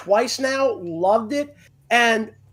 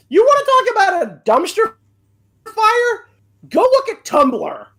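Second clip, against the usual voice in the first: excited rant; 12 semitones higher, 6 dB louder than usual. Real, not acted. Ranting about a dumpster fire.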